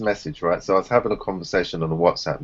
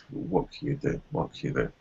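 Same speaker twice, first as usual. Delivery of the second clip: resigned whisper; 4 semitones lower, 10 dB quieter than usual. Real, not acted. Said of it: "resigned repeated question".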